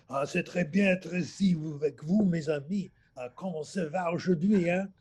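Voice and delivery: mock a French speaker with a thick French accent; schoolboy mocking the French panel in an overdone French accent; exaggerated French accent. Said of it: "he would exaggerate the French accent".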